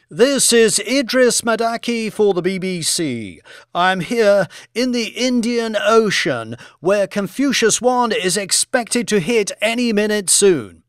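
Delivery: high-pitched on-air reporter; TV news reporter. Acted. Idris Madaki reports from the Indian Ocean, news reporter voice.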